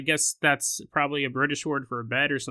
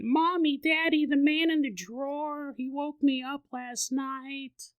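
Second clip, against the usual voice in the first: put-on high, slow child's voice; a toddler insists to Daddy; high child voice, slow at the end; insistent little girl; 10 semitones higher, 4 dB quieter than usual. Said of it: "she keeps saying".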